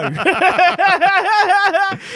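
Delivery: loud, high-pitched laughing fit; bursting into laughter. Real, not acted. Podcaster cracking up at the co-host's heroin remark, loud laughter. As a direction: crack up helplessly and loudly.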